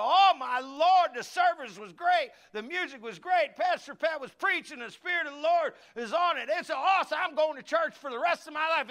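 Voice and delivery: high, loud gushing on the phone; excited churchgoer raving to family by phone; gushing excitement. Retold or imitated. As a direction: rave about church like you can't contain it. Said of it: "get on the phone and go".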